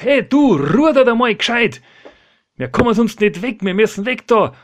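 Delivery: loud, high telling-off; scolding shout. Acted. One rower scolds another who has stopped rowing, loud and cross. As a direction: yell crossly at a slacking rower. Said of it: "Dann schimpft der andere und sagt".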